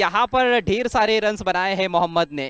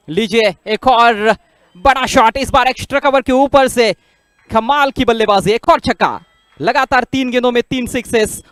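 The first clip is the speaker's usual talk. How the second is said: excited cricket commentary; 4 semitones higher, 7 dB louder than usual. Real, not acted. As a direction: savour each six, louder each time. Three sixes in a row. (जोशीली cricket commentary)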